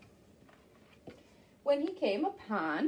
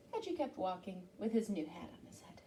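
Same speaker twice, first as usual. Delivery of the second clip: whisper; 5 semitones lower, 11 dB quieter, faster than usual. Acted. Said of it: "whispered narration".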